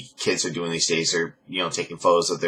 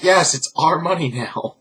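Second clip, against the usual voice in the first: smug husband; 9 semitones higher than usual. Retold or imitated. Voicing Daniel's reply.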